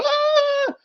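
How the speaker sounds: put-on sudden high shriek of a fleeing girl; mock scream. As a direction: imitate a scared girl's squeal. Girl ran off scared, imitated.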